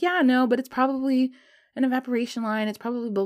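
high-pitched, waving it away; dismissive brush-off. Retold or imitated. Explaining away the faint test line to partner, dismissive brush-off.

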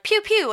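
high pew pew gun sounds; gunshot noises. Retold or imitated. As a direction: fire off two playful pews. Imitating gunshots.

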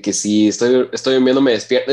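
usual speaking voice

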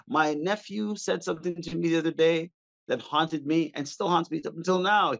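ordinary talk

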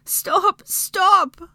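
high, loud pleading cries; begging. A narrator's reading. Hermione jostled against stone, begging. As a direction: beg loudly, pitched right up. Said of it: "Hermione begged".

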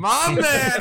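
very high, delighted whoop of approval; excited cheer. Real, not acted. Host hyped by guest agreeing to swear, excited cheer. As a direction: cheer him on in a soaring voice.

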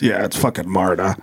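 bar guy's voice. Retold or imitated. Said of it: "And he goes".

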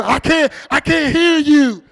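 high, very loud oblivious yell; mock deaf shouting. [name] pretending not to hear in headphones, exaggerated loud shout. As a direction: shout it slowly, as over blaring headphones.

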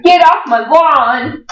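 put-on high, slow cranky old man shout; grumpy old man. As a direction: yell it like a cranky old neighbor.

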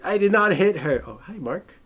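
hammed-up indignant denial; The Room impression. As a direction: quote the melodramatic denial with force. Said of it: "it's almost like The Room".

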